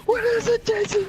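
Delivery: high-pitched shouting father; alarmed dad. Acted. Dad barging in to help the son, alarmed. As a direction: burst out as a panicked dad.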